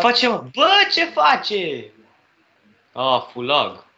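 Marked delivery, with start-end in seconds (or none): 0.0-1.9 baffled. Real, not acted. Horse glitching.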